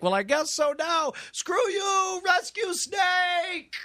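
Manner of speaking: very high, stretched-out comic holler; hammed-up high wail, last word drawn long; mock falling yell. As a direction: cry out as if plummeting off a cliff. Acting a person falling off edge.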